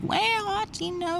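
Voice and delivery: high, slow, drawn-out East Texas twang; playful East Texas accent, high and slow. Retold or imitated. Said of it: "East Texas accent might be my favorite".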